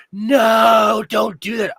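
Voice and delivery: loud, high panicked shout. Retold or imitated. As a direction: yell it out in alarm. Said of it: "I can hear screaming".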